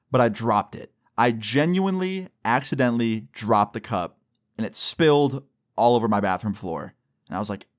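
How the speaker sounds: usual speaking voice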